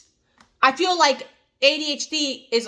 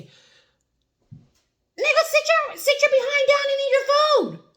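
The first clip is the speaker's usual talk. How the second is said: much higher, sharp mock scold at the husband; scolding wife. Wife ordering her pacing husband to sit down and eat, high mock scolding. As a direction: tell him off like a fed-up wife.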